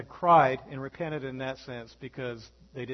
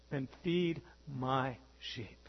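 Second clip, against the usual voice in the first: slow, soft, lower charge; Jesus commissioning Peter, slow and softer; calm commissioning Jesus; 3 semitones lower, 6 dB quieter, much slower than usual. Retold or imitated. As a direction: give the charge gently and slowly. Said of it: "Third time".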